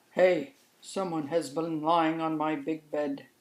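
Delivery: a hint of a gruff father mouse, mildly indignant; Papa Mouse in a mildly put-on voice; lightly voiced Papa Mouse. Papa Mouse finds someone sat in his chair, lightly voiced.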